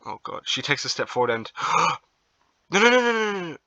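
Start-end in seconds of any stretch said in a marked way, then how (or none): 2.7-3.6 high, loud panicked cries. Real, not acted. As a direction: shout in horror. Dream girl about to jump.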